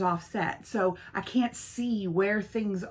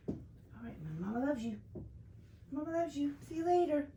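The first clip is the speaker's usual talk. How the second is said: higher, soft, cooing farewell; tender pet talk; 7 semitones higher, 9 dB quieter than usual. Real, not acted. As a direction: softly coo goodbye to the dog. Sending the dog off.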